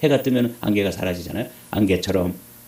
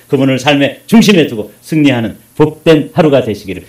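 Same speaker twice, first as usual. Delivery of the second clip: preacher's closing blessing; 9 dB louder than usual. Real, not acted. Preacher blesses the congregation in the Lord's name, loud and building. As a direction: build forcefully into the final invocation.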